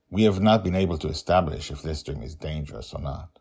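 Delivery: ordinary delivery, nothing marked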